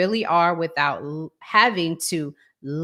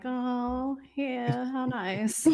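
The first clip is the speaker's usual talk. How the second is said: sarcastic; 5 semitones higher, 9 dB quieter, much slower than usual. Retold or imitated. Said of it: "And I'm like".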